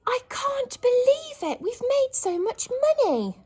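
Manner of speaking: high, loud joyful cry; thrilled disbelief. Shown as a demonstration. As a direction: gasp out joy at the money. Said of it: "exclamation mark for happiness".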